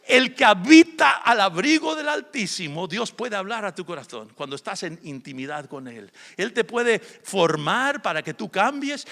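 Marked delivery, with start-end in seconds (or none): 0.1-2.9 rising, louder preacher's cry; preaching at full force. Real, not acted. Pastor preaching to the congregation, full force. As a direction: proclaim it to the congregation.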